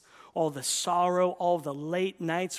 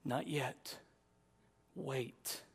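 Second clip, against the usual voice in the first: quiet, measured reply; Jesus answering calmly; 3 semitones lower, 7 dB quieter, much slower than usual. Acted. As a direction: gently hold back a desperate pleader. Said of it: "And Jesus says".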